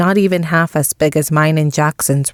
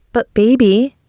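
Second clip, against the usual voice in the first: higher, put-on coaxing woman's voice; wheedling; 6 semitones higher, 3 dB louder than usual. A narrator's reading. Jennifer coaxing her angry former lover, wheedling. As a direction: plead with a sweet wheedle.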